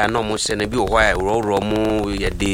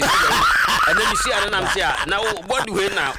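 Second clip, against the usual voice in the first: laughing shout; 11 semitones higher than usual. Real, not acted. A guest laughing as he talks to the host.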